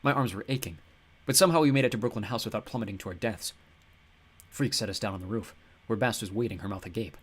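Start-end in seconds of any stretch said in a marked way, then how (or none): none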